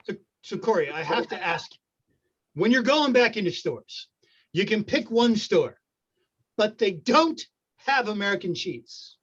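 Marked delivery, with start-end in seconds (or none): none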